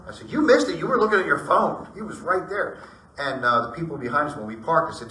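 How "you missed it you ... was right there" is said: high and loud, insistent; retold exclaim. Husband telling wife she missed the ghost, raised excited voice. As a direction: tell her off, she missed it.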